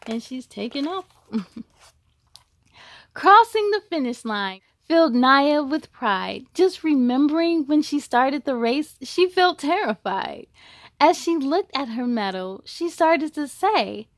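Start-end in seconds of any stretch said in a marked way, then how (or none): 3.1-14.1 rhyming storybook read with a steady lilt; picture-book narration; reading to children. A narrator's reading. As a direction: read it to a class of kids. Reads a picture book aloud.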